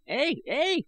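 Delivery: high shrill cackling eh eh; shrill it out like a gleeful old man; shrill old cackle. A narrator's reading. Old I Gos cackling at the silent warriors, shrill. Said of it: "he shrilled".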